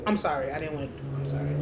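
normal talking voice

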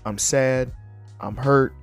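normal talking voice

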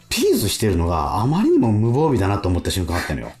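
usual speaking voice

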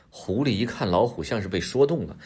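plain reading voice

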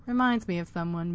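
ordinary delivery, nothing marked

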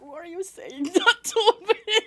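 high, loud, laughing indignation; mock offended with a laugh. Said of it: "I come like".

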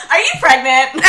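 loud mock question breaking into laughter; joking, laughing. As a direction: blurt it out as a joke, about to crack up. Joke question, bursts out laughing.

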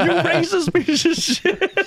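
very high, slow comic outburst through laughter; Tracy Morgan. Retold or imitated. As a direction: squeal the insult in imitation, laughing. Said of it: "Tracy Morgan has a very distinct voice".

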